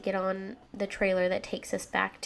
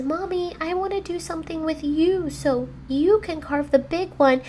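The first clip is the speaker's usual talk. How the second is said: put-on higher, sweet-talking kid voice; pleading child; 6 semitones higher, 3 dB louder than usual. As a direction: coax Mommy like a little kid. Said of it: "he says".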